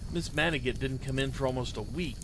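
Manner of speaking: ordinary delivery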